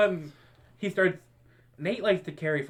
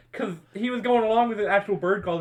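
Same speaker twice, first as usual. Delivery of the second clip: ordinary talk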